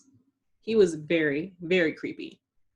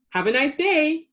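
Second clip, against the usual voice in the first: calling out; 7 semitones higher, 5 dB louder than usual. A narrator's reading. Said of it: "the professor called out".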